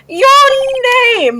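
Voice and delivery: loud, high, drawn-out cry; pompous demand. Acted. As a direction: call it out grandly, stretching both words. CEO character demands the name.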